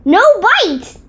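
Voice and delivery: high, loud bratty refusal; shouting baby. A narrator's reading. Baby refusing the egg, shouting. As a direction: spit it out like a toddler.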